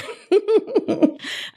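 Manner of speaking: bright high-pitched giggle. Real, not acted. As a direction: burst into a squeaky laugh. Laughs at own impression.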